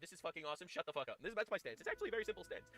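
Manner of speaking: ordinary delivery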